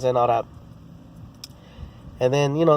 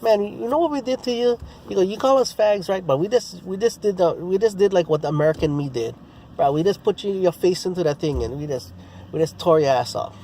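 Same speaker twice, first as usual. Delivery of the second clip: higher, gloating taunt; gloating higher trash talk; 6 semitones higher than usual. Retold or imitated. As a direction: boast about crushing his team. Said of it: "I was just going in on him".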